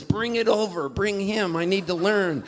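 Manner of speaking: a hint of an insistent mom; lightly voiced mom. Mom eagerly overruling dad.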